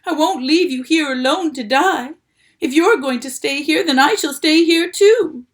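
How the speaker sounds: loud, high protest; desperate. A narrator's reading. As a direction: insist at the top of his voice.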